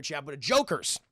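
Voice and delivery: ordinary delivery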